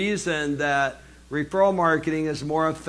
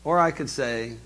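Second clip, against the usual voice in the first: ordinary talk, nothing marked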